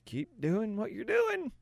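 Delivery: high, slow, hesitant doctor voice; baffled doctor. Acted. Doctor conceding to a recovered patient, high halting bafflement. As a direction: stammer reluctantly, pitched well up. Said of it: "the doctor's gonna be like".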